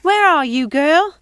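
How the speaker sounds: put-on calling out for a missing child; worried calling. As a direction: shout into the house for her. Calling for lost girl.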